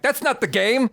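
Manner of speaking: high, emphatic mock-pleading; exasperated insistence. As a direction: insist it like it's obvious. Insisting on Burrito Wednesday to Achara.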